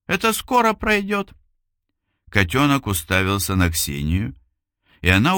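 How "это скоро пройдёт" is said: high sweet voice. Acted. Seller reassures about kitten.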